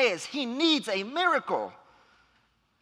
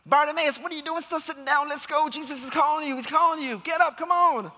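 high, excited hurrying-along; acted crowd eagerly egging someone on, building louder; urgent urging; 6 semitones higher, 3 dB louder than usual. Crowd urging Bartimaeus to get up, excitedly. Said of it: "they're singing a different tune".

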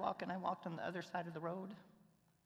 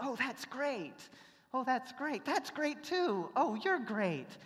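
higher, louder gushing "great"; 8 semitones higher, 6 dB louder, slower than usual. Retold or imitated. [name] mimicking tour visitors, higher and gushing. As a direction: parody polite tourists, slow and sing-song. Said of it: "they said".

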